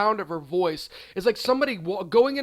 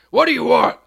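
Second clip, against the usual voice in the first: rough, explosive mock bellow; nasty sandwich guy's angry yell; 6 semitones higher, 12 dB louder than usual. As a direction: bark it at a customer, very loud. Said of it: "why are you yelling at me".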